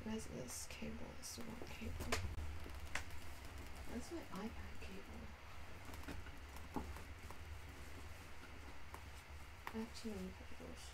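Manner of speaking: very soft, half-whispered mumble; muttering to oneself. Real, not acted. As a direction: talk under your breath while hunting for something. Mutters to self hunting cable.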